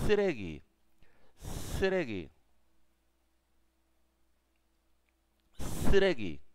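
teacher drills Sregi loud and clear; tense double s. Shown as a demonstration. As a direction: teeth closed, push air through the s of Sregi. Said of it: "Cuando cierras los dientes".

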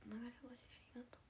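soft unvoiced whisper of thanks. Real, not acted. Streamer whispering thanks to a viewer for a gift. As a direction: breathe the thanks out without voice.

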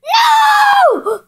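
high, panicked shriek of no. Real, not acted. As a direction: yelp it in alarm. Nearly falling in game.

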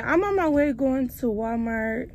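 ordinary talk

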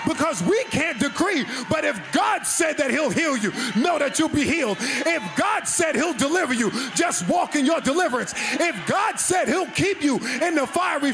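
full-force preaching. Acted. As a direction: build the congregation to a roaring finish.

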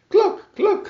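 high, loud hen call; calling hen cluck. A narrator's reading. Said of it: "she called".

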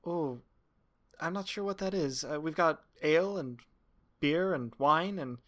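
high, timid little barmaid voice; a barmaid in awe of a noble, asking meekly; shy barmaid. Acted. Barmaid unsure of the drink.

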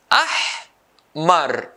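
"Ahmar" split into ah and mar, long pause between; syllable by syllable. Shown as a demonstration. Teacher dividing a colour word, syllable by syllable. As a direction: break "Ahmar" into two isolated beats. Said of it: "two syllables".

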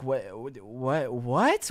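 low, faltering bewilderment; baffled cashier. Retold or imitated. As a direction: stammer quietly, lost for words. Cashier baffled by the customer's demand.

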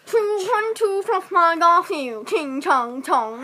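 slow, crude imitation of Chinese; mock Chinese accent. Shown as a demonstration. Player shows friend what to say, mock Chinese accent. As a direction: drag out a badly faked Chinese accent. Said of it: "You say".

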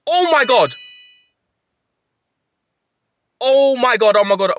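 real high, loud shriek of joy; shrieking jackpot winner. Real, not acted. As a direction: scream it in stunned disbelief. Rare shiny hundo just appeared.

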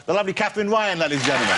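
lifted show-host sign-off; host announcement. Acted. Talk show host sending off the guest, announcer style. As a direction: sell the guest to the crowd.

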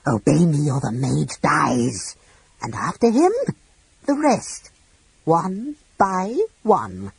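slow, rising dragon threat; menacing dragon. A narrator's reading. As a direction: build the threat slowly. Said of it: "lowering her head menacingly".

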